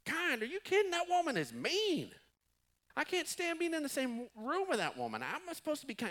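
a husband aghast that he must be nice to his wife; incredulous outraged husband. Acted. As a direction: squeal it like a husband who can't believe what he just heard. Husband voiced, outraged at advice.

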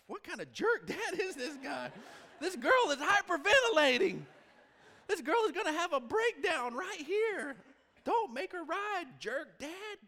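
high, loud mocking crowd voice; judging strangers. Strangers in line scolding the dad, hammed-up and loud. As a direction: ham up the outraged bystanders. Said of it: "the people behind me are like".

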